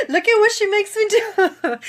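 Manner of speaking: high, loud wail through laughter; tearful laughing. Real, not acted. Client shows viewers what her coach does, tearfully laughing. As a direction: protest helplessly through happy tears. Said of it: "I would be on here crying".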